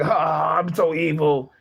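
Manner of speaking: mock evil. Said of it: "be like".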